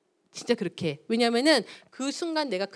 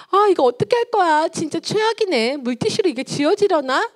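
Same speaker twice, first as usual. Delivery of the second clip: shrieking, much higher and louder scolding; angry mother; 8 semitones higher, 6 dB louder than usual. Acted. Mother scolding her child for drawing on the wall, loud and high. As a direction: explode at the child over the scribbled wall. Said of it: "엄마는 막".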